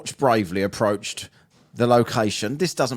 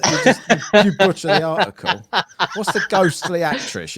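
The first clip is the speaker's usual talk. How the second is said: laughing; 7 semitones higher, 5 dB louder than usual. Real, not acted. Laughing while teasing.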